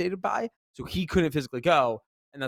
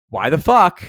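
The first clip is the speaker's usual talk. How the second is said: loud exasperated; 2 semitones higher, 10 dB louder than usual. Retold or imitated. Exasperated quote of admins noticing.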